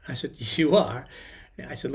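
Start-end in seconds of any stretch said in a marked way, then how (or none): 0.6-1.1 firm, louder on You are; insisting. Retold or imitated. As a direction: press the point home. Insisting to the radio host.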